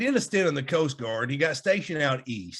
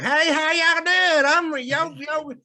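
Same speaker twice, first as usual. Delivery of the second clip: mock Southern drawl, higher and louder, slow and folksy; fake Southern accent; 12 semitones higher, 5 dB louder, slower than usual. Coach introduced at a basketball game, hammed-up fake Southern accent. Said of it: "he develops this fake Southern accent".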